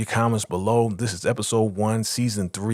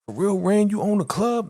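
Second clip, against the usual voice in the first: high-pitched, excited disbelief; amazed; 12 semitones higher than usual. Retold or imitated. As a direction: retell it as thrilled astonishment. Retold amazed, happy reaction.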